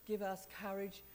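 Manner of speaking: ordinary talk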